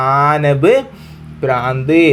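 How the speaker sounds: ആനബ് then a pause, then ഭ്രാന്ത്, each part given alone; teacher dictating the parts one at a time; split for dictation. Shown as a demonstration. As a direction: break ആനബ് ഭ്രാന്ത് into two slow chunks. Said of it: "അങ്ങനെ pronounce ചെയ്തോ".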